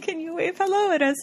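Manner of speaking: high attempt at an Irish accent; Gary. Gary asks for a wave, giggly mock Irish accent. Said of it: "he did it with an Irish accent".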